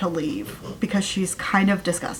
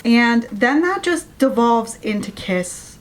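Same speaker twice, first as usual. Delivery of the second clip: normal talking voice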